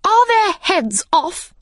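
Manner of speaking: high, slow, imperious shout; shouted question. Acted. Queen checking on the execution, shouting. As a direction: demand it at the top of the register. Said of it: "shouted the Queen".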